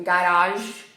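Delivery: "garage" said as a loanword; foreign pronunciation. Shown as a demonstration. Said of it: "não é uma palavra italiana".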